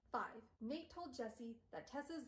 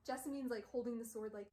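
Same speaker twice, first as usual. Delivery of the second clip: usual speaking voice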